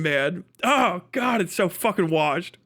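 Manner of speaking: usual speaking voice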